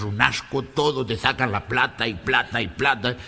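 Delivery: put-on low grumbling list in a Spanish accent; grumpy Galician, deep and monotonously complaining; disgusted Galician. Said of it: "Volvió muy enojado el gallego". (gallego rezongón, con voz grave)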